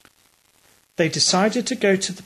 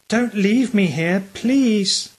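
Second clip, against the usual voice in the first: higher, mild frightened plea; pleading cry; 5 semitones higher, slower than usual. A narrator's reading. Ralph begs the man, mild frightened plea. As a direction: beg not to be left, a touch scared. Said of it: "cried Ralph".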